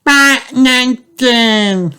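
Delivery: put-on high, slow, drawn-out toddler voice; giggling toddler. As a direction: stretch it out like a giggling little boy. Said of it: "giggles George".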